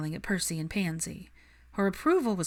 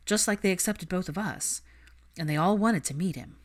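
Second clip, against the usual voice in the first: usual speaking voice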